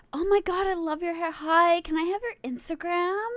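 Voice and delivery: put-on high, loud excited girl voice; gushing fangirls. Girls asking streamer for her Instagram, imitated gushing. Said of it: "so many girls were like".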